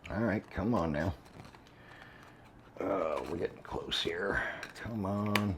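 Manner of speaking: soft, low self-talk while fiddling; muttering to self. Real, not acted.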